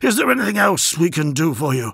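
eager to please his lord; courtier voice. Acted.

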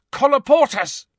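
loud, high incantation cry; shouted spell. A narrator's reading. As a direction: yell the spell with full urgency. Shouting a door-sealing spell.

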